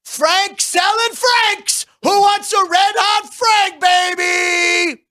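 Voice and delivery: high, slow, hammed-up hawker shout; hot dog vendor call. Host shows his own stadium call, a slow high hawker shout. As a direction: stretch it out like a stadium barker. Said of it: "so I'll be like".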